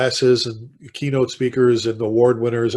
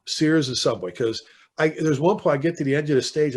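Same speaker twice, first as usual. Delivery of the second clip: usual speaking voice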